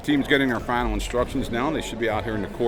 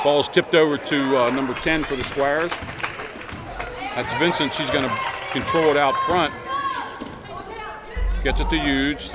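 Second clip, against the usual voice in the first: fast sports commentary; 5 semitones higher, 3 dB louder than usual. Acted. Live play-by-play of a game.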